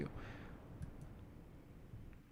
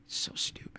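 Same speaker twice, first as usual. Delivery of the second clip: whisper; 15 dB quieter than usual. Real, not acted. Grumbling at the hard word.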